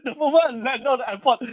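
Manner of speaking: normal talking voice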